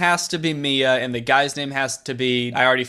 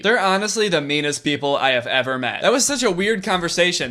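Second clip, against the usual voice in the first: normal talking voice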